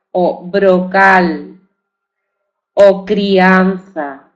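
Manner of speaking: each example word said slowly and clearly; teacher modelling brocal, crianza, dromedario; slow demo words. Shown as a demonstration. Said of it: "En los grupos consonánticos también".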